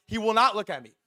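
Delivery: ordinary talk, nothing marked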